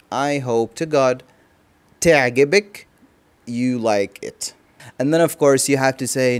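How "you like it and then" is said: usual speaking voice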